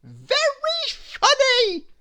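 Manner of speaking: very high, loud, drawn-out goofy voice; silly Mister Funny. Acted. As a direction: stretch out the punchline. Mister Funny joke line.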